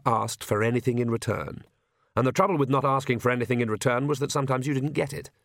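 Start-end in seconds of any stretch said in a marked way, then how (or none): none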